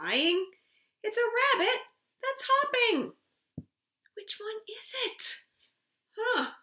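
lively storybook voice, high and loud; reading to a toddler. Acted. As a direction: read it big for little ones. Reads picture book aloud.

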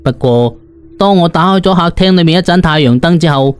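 plain reading voice

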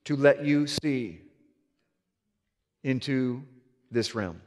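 very low, slow and soft, each phrase drawn out; a deep hushed voice asking if he truly wants to see; voice of the Father. Retold or imitated. The Father speaking gently to the preacher, low and slow. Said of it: "And the Father said".

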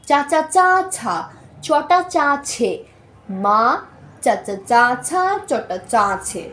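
slow, the aspirated ছা set apart from চা; separating চ and ছ. Shown as a demonstration. Teacher separating চ from ছ for students, slowly. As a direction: linger on চা then breathe out ছা. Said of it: "দুইটা কিন্তু চ না".

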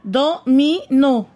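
"Domino" slowly in three separate beats; syllable by syllable. Shown as a demonstration. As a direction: break "Domino" into do, mi, no. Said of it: "Frappe les syllabes". ("Domino" مقطعًا مقطعًا ببطء)

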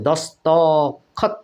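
দস্তখত said slowly as dos-to-khot, the middle o held; sounded middle vowel. Shown as a demonstration. Teacher proving the vowel is heard, slow split syllables. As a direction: draw out the to in দস্তখত, pause, then khot. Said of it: "এখানে অ পর্যন্ত উচ্চারিত হচ্ছে".

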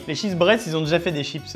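ordinary delivery

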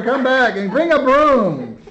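ordinary delivery

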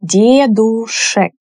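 дедушек said slowly, every sound clear; slow model word. Shown as a demonstration. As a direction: draw out дедушек for learners. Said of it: "same thing".

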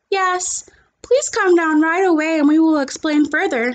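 a hint of a busy school nurse. A narrator's reading. Nurse calling a parent, lightly voiced. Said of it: "she said".

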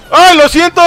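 high, loud blurted apology; shouted mock apology. Player apologizing to game characters amid chaos, shouted apology. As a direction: shout sorry in a high voice, about to laugh.

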